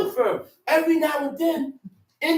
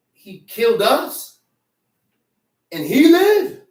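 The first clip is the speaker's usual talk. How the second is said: incredulous reproach; 6 dB louder than usual. Retold or imitated. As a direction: voice leaps up in disbelief. Acts out bitter question to David.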